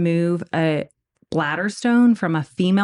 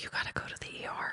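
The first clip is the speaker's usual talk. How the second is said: fast hushed whisper; urgent low whisper; whispered urgency; 18 dB quieter, much faster than usual. Retold or imitated. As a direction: whisper it quickly, serious. Surgeon whispering urgent advice.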